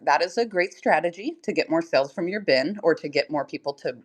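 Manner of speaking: normal talking voice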